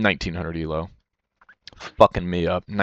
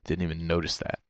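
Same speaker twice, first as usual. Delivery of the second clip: usual speaking voice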